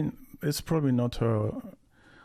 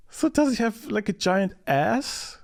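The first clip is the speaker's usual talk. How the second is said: high, laughing questions; amused disbelief; 10 semitones higher than usual. Real, not acted. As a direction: ask while still laughing. Laughing about villain's body.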